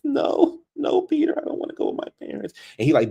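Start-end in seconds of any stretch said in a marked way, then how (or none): 0.0-1.3 squeaky plea. Retold or imitated. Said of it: "she's like". 1.3-2.5 mock whisper. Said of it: "she's like".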